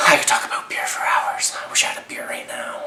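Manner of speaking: hushed confession of a craving; whispered aside. Real, not acted. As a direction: whisper it like a secret to the camera. Whispered aside about beer.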